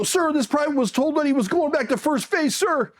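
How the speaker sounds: high, formal recruit report; mock boot-camp sir-sandwich delivery; imitated recruit reporting. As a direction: report up to a superior, voice pitched high.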